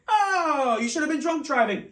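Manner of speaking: very high, loud mock-outraged joke; joking DA. DA cracking a joke at the nervous teen, high and loud. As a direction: ham it up like a comedian DA. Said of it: "He goes".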